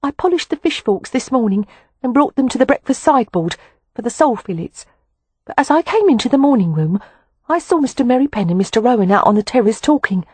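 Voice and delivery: put-on a servant girl afraid to speak up; nervous housemaid. A narrator's reading. A housemaid nervously reporting to the lord of the house. Voices frightened housemaid Sylvia.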